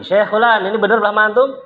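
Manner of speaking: loud, high call to the next teacher. Retold or imitated. The Syekh calls the next teacher to confirm, loudly. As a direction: summon him to confirm. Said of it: "Dipanggil lagi".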